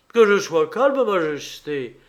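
loud, high outburst; indignant. A narrator's reading. Pierrot refusing to calm down for the king, indignant. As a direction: throw the words back in indignation.

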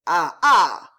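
tense loud grunting ah ah; fists clenched. Acted. The servant clenches his fists in anger. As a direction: strain with clenched rage. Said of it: "fit l'homme dont les poings se crispèrent".

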